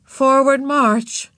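high, slow, loud call; marching order. A narrator's reading. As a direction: call out the command, stretched. Mother calls out the parade.

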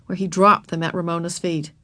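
ordinary delivery, nothing marked

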